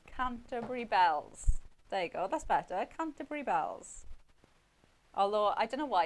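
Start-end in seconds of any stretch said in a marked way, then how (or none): 0.1-1.6 "Canterbury bells" slow and loud; loud, drawn-out go at "Canterbury bells"; accented attempt. Real, not acted. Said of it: "I'm butchering that as well". 3.0-4.1 "Canterbury bells" higher and louder; raised, emphatic go at "Canterbury bells"; accented attempt. Real, not acted. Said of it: "in my accent".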